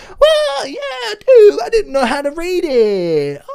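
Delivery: very high, loud, wailing whine; mock whining customer. Customer caught not reading the email, high mock wail. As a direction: wail it like a hammed-up crybaby, stretching the end.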